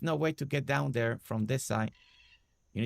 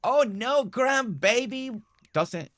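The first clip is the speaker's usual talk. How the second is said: high, loud, drawn-out oh no; playful dismay; 11 semitones higher, 6 dB louder, slower than usual. Pretend. Player reacting to the grandbaby, playful dismay. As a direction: exclaim in mock alarm, stretched out. Exclaims over grandbaby in the game.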